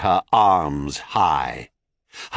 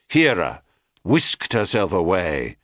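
usual speaking voice

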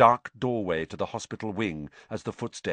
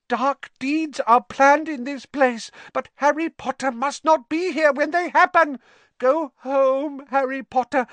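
high, loud, urgent elf voice; squeaky house-elf pleading insistently; Dobby warning; 5 dB louder than usual. A narrator's reading. House-elf urges Harry to go home, high and urgent.

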